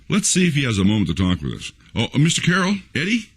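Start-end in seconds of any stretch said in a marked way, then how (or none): none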